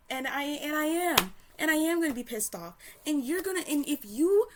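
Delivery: loud, high angry outburst; real furious yelling; pissed off. Real, not acted. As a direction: shout it, furious and unfinished. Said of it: "I truly am pissed off".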